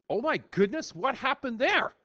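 high-pitched playful astonishment; mock surprise. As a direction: act surprised at what turned up. Teacher feigns surprise at the graph.

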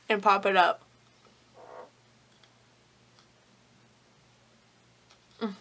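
lips pulled aside by fingers; talking with hand at mouth. Real, not acted. Seen 0: Fingers hook lip corners and reach back molars, briefly clear at 2.3.

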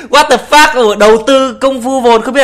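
loud, high disbelieving cry; shocked. Real, not acted. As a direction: blurt it out in disbelief. Shocked reaction to the clip. (thốt lên kinh ngạc)

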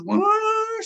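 high, loud startled bear wail; freaked-out bear. Retold or imitated. Bear rearing up at the gunfire, high loud cry. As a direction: imitate a bear rearing up in alarm. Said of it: "It goes".

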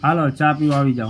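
loud call across the house. Real, not acted. Calling the household to come for tea, loud call.

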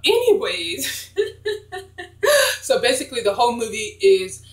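real laugh breaking up the words. Real, not acted. Amused after the clip, laughing while talking to viewers.

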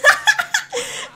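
loud, high-pitched cackle; high laugh. Real, not acted. Streamer confesses liking dads, high cackle.

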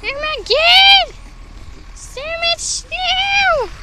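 high, slow, hushed wonder; drawn-out marvel at the snow; awed. Real, not acted. Amazed at the snow.

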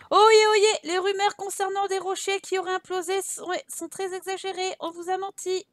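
high proclaiming town-crier voice; crier's public proclamation. Acted. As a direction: open loud, then announce it to the whole town. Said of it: "Informer la crieuse de la ville".

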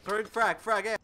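loud, high urgent callout; grenade warning yell. Real, not acted. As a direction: bark the grenade warning loudly. Grenade throw callout in combat.

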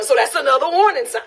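ordinary delivery

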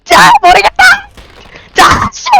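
very high, loud drawn-out yell; desperate scream. Real, not acted. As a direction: scream it out, throwing caution away. Desperately throws at monster. (될 대로 되라는 절규)

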